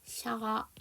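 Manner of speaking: "샤악" softly sounded out with a drawn-out vowel; demonstrated pronunciation. Shown as a demonstration. Teacher shows how shark should sound, demonstrated pronunciation. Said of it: "이렇게 발음이 나야 되겠죠".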